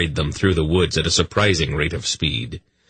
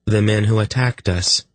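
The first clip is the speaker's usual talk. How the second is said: sick voice; 4 semitones higher than usual. A narrator's reading. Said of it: "with a sick voice".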